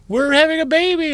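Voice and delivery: sing-song fake-thrilled announcement; mock excited announcer. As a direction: gush it like a proud parent posting. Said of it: "people post on Facebook. They're like".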